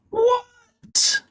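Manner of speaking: a single word shooting way up in pitch; real disbelief at the news; shocked what. Real, not acted. Streamer reacting to a viewer whose heat went out, shocked.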